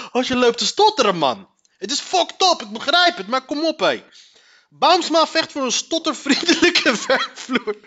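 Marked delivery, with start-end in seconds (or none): 4.7-7.8 slow, laughing through the words; trying to read while cracking up. Real, not acted. Vlogger reading the article aloud, laughing.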